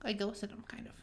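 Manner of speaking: sheepish whisper. Real, not acted. Confessing guiltily to friend.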